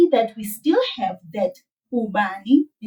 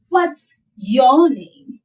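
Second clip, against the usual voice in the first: slow, careful and a bit louder; slow teaching demo; 3 semitones higher, 6 dB louder, much slower than usual. Shown as a demonstration. Teacher modelling a question for students, slow and clear. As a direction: spell it out slowly for a learner. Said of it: "Do you hear?".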